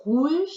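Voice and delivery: name rouge brightly; French pronunciation. Shown as a demonstration. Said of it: "is red".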